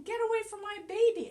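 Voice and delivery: high, loud mother sheep warning; mommy sheep telling the puppy off, high and loud; protective mommy sheep. Pretend. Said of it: "She's saying".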